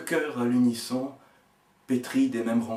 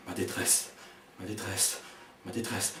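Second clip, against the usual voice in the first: usual speaking voice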